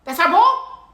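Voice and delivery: lightly mimicked incredulous question; high, rising mock disbelief; LeBron James. Imitating a player questioning whose ball it is, incredulous. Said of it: "You know how LeBron was like".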